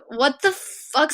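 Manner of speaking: slow, drawn-out "What the fuck?"; stunned disbelief. Retold or imitated. Retelling reaction to boyfriend's excuse, slow stunned disbelief. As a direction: spell out shock at the boyfriend, slowly. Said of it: "And I was like".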